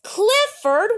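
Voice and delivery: high, loud rising announcement; excited reveal. A narrator's reading. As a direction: reveal the winner with a thrill. Reveals the election winner.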